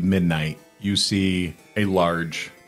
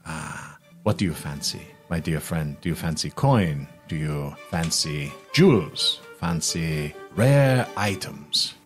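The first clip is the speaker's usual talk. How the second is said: wheedling merchant. Acted. Merchant asks his friend what payment he fancies, wheedling. Game master voices Jubal probing price.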